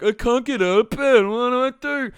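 high, loud whiny voice; hammed-up despair; mock helpless whine. Coach mimicking himself and everyone, high whiny plea. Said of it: "my impression of, like, everyone".

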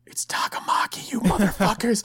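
whispered mock retort, voice returning on the curse; exasperated whisper. Host retorting to the game's bad pronunciation, exasperated whisper. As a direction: hiss the correction under your breath, then voice the insult.

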